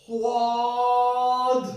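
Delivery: سواد drawn out long and loud, heavy thick ص; ص pronounced heavy in سواد; heavy Saad sound. Shown as a demonstration. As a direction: stretch سواد slowly with a full, thick Saad. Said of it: "وہ موٹی آواز میں پڑھتے ہیں".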